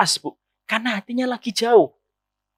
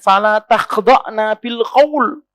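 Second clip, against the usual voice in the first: Quranic recitation; 3 dB louder, much slower than usual. Acted. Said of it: "maka disampaikan".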